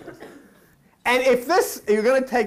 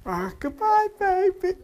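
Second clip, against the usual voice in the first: mock tearful Sarah; 12 semitones higher, slower than usual. Sarah's sad goodbye.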